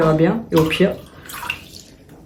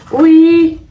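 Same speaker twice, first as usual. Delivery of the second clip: high pet voice; 8 semitones higher, 5 dB louder than usual. Real, not acted. Cat meowing, answering it.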